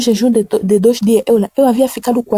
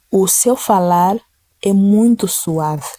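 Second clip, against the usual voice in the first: slow, gentle reading; much slower than usual. Shown as a demonstration. Preacher reading the verse to the congregation, slow and gentle. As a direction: read it slowly and tenderly.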